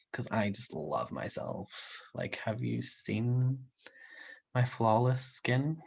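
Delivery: slow, hushed mock swooning; mock self-adoration. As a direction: pretend to adore yourself, softly and slowly. Jokingly gushing about her own looks.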